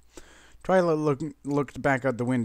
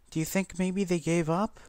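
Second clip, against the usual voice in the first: tremble; 6 semitones higher than usual. A narrator's reading. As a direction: ask with a timid tremor. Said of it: "with a tremble in her voice".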